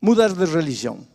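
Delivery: loud, driving sermon cadence, hammering each phrase; pregação inflamada e forte; preaching at full force. Acted. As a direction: preach it hard, pounding the repeated words. Preacher exhorting the congregation.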